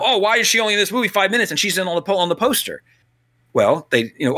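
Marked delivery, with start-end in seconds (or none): 0.0-2.8 high, fast whiny mockery of fans; sneering sing-song of a griping viewer; mocking fan complaint. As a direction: mimic the outraged online crowd. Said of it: "to say how".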